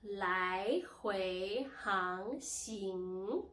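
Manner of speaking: 来回航行 very slow, each syllable sweeping upward; drawn-out rising-tone drill on 来回航行; second-tone demo. Shown as a demonstration. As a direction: stretch every syllable of 来回航行 into a rise. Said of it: "these are the four tones".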